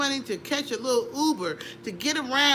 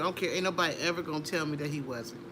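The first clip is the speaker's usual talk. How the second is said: normal talking voice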